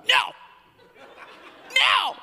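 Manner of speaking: put-on very high, loud child scream; child yelling no. Son yelling at his stomach, shrieking. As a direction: yell at your own stomach. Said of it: "yelling at his stomach".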